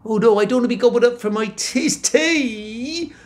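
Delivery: fast, high worried gabble; panicked. Acted. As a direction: blurt it out in a fluster. Jack panics.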